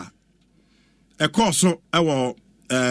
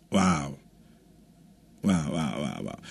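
fading wows; 14 semitones lower, 8 dB quieter, slower than usual. Acted. Fading repeated wow.

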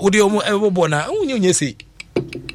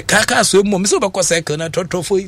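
ordinary delivery

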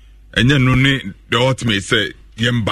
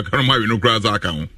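usual speaking voice

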